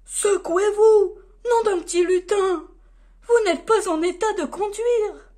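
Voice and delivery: loud, high scolding shout; Père Noël shouting. A narrator's reading. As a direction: bellow at the drunken crew to wake up. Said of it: "crier de sa grosse voix".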